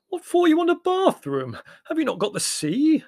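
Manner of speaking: very high, loud, baffled wail; anguished wail. A narrator's reading. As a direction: wail the questions in despair. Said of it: "he wailed in genuine anguish".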